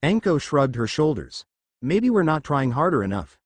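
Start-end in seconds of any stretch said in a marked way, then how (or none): none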